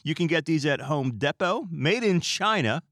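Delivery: high, loud showcase pitch; game show announcer. Shown as a demonstration. Showing how a game show host describes prizes. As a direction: present the household item like a grand prize. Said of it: "describing things in front of you".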